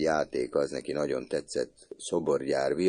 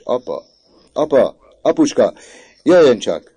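high, loud calls, climbing in urgency; calling out; 9 semitones higher, 13 dB louder than usual. A narrator's reading. Mother summoning her husband next door, calling out. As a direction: call father in from next room. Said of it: "alig tudott magához térni a kacagásból".

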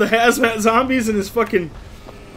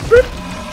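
cartoon boop; 6 dB louder than usual. Imitating jump sound.

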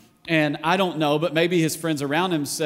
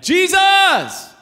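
a full-throated, much higher and louder call of "Jesus!"; loud shout; 11 dB louder than usual. Retold or imitated. Blind Bartimaeus cries out to Jesus passing by, shouting. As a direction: hurl "Jesus!" across the crowd. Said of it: "like, loud, like".

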